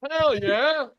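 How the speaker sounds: high loud whooping cheer; excited cheer. Real, not acted. Host cheering the great answer, a loud high whoop. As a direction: cheer like the crowd went wild.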